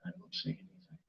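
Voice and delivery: hushed whisper in the dark. Real, not acted. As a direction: breathe the words, barely audible. Sitting blind in dark hole.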